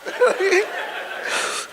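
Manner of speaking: high airy mock laugh, mostly breath; hammed-up imitation of a man's laugh; imitating his laugh. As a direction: copy the audience member's laugh, high and breathy. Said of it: "Cut the laugh off".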